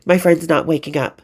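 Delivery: plain reading voice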